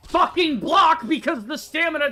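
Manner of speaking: loud, high, slow exasperated rant; frustrated rant. Real, not acted. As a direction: rant at the developers. Ranting about game stamina.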